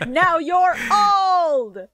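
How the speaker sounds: high, drawn-out shout; mock yell. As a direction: wail it out, stretching the last word. Mock taunt yelled out.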